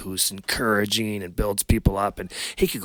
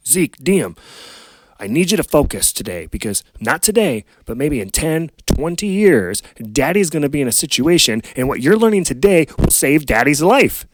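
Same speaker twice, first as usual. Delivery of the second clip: put-on louder, higher urging to his kids; dad impressing a lesson on his children, loud and urgent; urgent fatherly plea; 6 semitones higher, 7 dB louder than usual. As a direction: press it on the kids like a warning.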